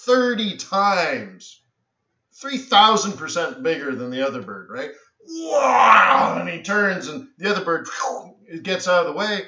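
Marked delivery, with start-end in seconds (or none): 5.3-6.4 high, loud, long "wow"; full-voiced mock screech; big swooping noise. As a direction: imitate a huge bird banking hard. Acting out the eagle's diving turn.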